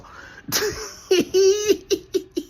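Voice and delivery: a long high burst of laughter; breaking into laughter at his joke. Real, not acted.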